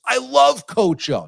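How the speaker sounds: high, slow, delighted gush; mock excited fan voice. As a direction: swoon over a favourite coach. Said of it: "She goes".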